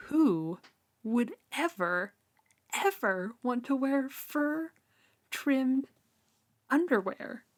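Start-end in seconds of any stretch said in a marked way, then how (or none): none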